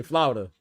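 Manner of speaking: "Florida" said as FLAW-da, no r, two syllables; southern Flawda. Shown as a demonstration. Asking if Florida folks say it, southern "Flawda". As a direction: drop the r: "Florida" becomes "Flawda". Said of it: "Like F L A W D A".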